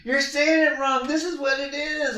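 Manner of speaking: high mock-teen correction; mock daughter. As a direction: play the know-it-all teen correcting dad. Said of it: "And my daughter say".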